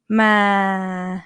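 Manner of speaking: "Ma" flat, in a female pitch; level "Ma" in a woman's register; female mid tone. Shown as a demonstration. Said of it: "Female ones will be like".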